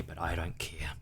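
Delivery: soft whispered defiance; whispered aside. Real, not acted. Owning up to viewers in a whisper. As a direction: whisper it like a guilty secret.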